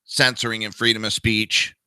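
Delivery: usual speaking voice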